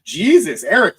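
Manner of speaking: sudden high, loud "Jesus" of disbelief; surprised exclamation. Real, not acted. Streamer reacting to a chat comment, startled exclamation. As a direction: blurt "Jesus" as if caught off guard.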